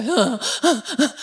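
short repeated breathy "ah"; imitated gasps of relief. Cello learner cooling sore fingers in freezer, gasping relief. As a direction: act out sore hands meeting frozen peas. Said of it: "and just go".

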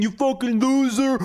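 a mock yell in a put-on voice as the mask-off guy; imitating an angry shopper. Mimicking the guy yelling at the woman, mock yell. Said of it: "The guy yelling at the woman saying".

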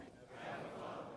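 breathy whispered Abba, Father; barely a breath, as if praying inside; whispered prayer. Shown as a demonstration. Repeated softly after calling it out.

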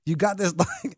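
ordinary talk, nothing marked

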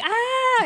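high, loud comic yelp of alarm; mock shriek. Billy reacting to the wrong building, mock shriek. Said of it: "He's like".

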